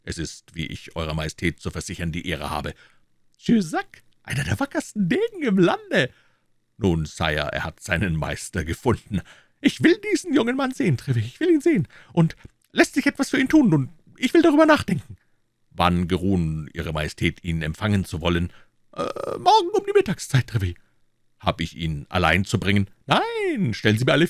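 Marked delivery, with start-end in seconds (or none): 3.4-6.1 very high-pitched king's voice; amazed king. Acted. King marvelling that Jussac was beaten, amazed high voice. 9.6-15.2 fast, very high-pitched royal demand; excited king. Acted. King demanding to see the young man, excited high voice. 18.9-20.8 fast, very high-pitched king; brisk king. Acted. King setting an audience with Treville, brisk high voice.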